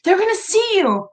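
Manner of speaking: high, scolding grandma voice; a fussy grandma scolding her granddaughter; grandma warning. Retold or imitated. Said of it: "She goes".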